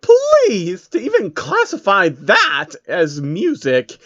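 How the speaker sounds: high, slow snooty disdain; annoyed Rarity. Acted. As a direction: look down on the music. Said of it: "sounding annoyed with the music".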